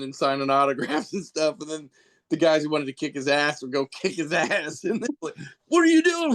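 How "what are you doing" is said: high, loud what are you doing; mock angry yell. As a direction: bark it like an outraged wrestler. Wrestlers confront the superhero.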